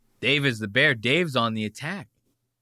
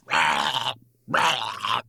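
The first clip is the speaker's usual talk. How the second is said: imitated bear roar into the mic; Joe Rogan's bear impression. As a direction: growl out two bear roars. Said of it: "Joe Rogan does his bear impression".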